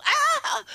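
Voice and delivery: put-on sudden high yelp of a hurt toddler; child's yelp. Imitating toddler crying out at the park with a high yelp. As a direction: yelp high like a little kid. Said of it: "I hear her go".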